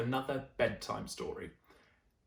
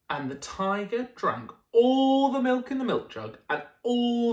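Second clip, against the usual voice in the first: put-on lively storytelling voice for children; animated read-aloud; bedtime story narration; 6 semitones higher, 7 dB louder than usual. A narrator's reading. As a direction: read it like a picture book at bedtime. Reads picture book aloud.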